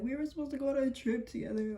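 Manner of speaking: high-pitched mock whine; imitated crying. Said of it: "she starts crying".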